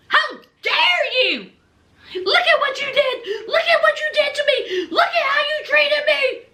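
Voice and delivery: high, tearful wailing accusation; hammed-up sobbing wife blaming Gino; mock crying. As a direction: whimper it out laughing and crying. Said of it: "she went ballistic".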